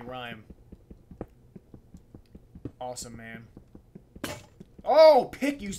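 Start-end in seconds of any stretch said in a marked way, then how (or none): 4.8-5.7 sudden loud high outburst; frustrated yell. Real, not acted. Gamer exploding at his pickaxe, loud frustrated yell. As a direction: blow up at the tool.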